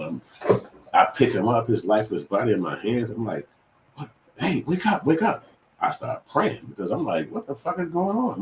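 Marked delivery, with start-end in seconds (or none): 3.9-5.4 high, frantic cry to wake him; panicked plea. Retold or imitated. Father begging his fainted son to wake, frantic and high. 7.3-8.4 high, frightened outcry; panicked disbelief. Retold or imitated. Father over his limp son, high and frightened.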